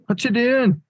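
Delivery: very high, loud British accent, hammed up; bad British accent. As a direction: scold the chart in a hammy British accent. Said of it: "It's a horrible accent".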